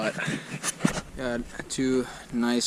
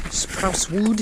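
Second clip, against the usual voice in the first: playful higher; 7 semitones higher than usual. Acted. Repeating the word playfully.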